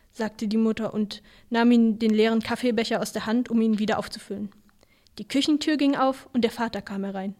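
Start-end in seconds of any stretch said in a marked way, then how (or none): none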